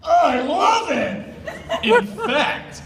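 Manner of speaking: high, slow, laughing shout of glee; excited laughing shout. Acted. Narrator voicing a thrilled Zoroark, laughing shout. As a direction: burst out in delighted laughter.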